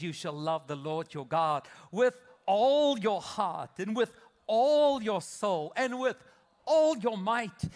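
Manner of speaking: rising preached reading of the verse; building scripture proclamation. Acted. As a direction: read it out big and slow, climbing to full force. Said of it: "let's read it together".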